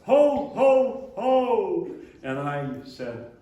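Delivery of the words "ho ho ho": loud, high ho ho ho shouted from the bus; Santa yell. Retold or imitated. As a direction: belt it out like a jolly Santa. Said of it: "he yells out".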